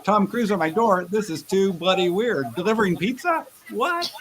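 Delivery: rising disbelief, pitch climbing high; growing astonishment, ending in a high What; incredulous. Retold or imitated. Acting out lucid realization.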